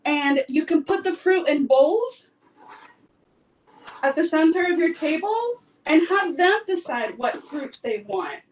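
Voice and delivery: ordinary talk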